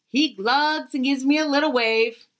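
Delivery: ordinary delivery, nothing marked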